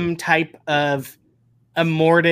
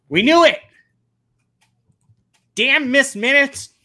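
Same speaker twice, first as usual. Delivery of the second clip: mock outrage; 8 semitones higher, 3 dB louder than usual.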